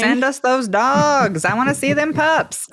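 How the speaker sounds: hyped, slow and high; playful call-out. Real, not acted. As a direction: stretch it out like a dog-crazy cheer. Playful call-out for dog pictures.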